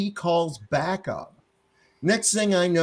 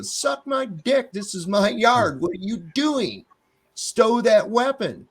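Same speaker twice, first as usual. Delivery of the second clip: higher, slow, deliberate defiance; defiant retort; 7 semitones higher, slower than usual. Retold or imitated. Homeowner defying cop in his yard, slow higher defiance. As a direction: spit each word slowly at him.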